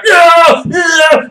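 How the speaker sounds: high, loud, hammed-up cheers; imitated player yells. Defenders jumping to block the field goal, mock excited yells. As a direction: yell it out like leaping defenders.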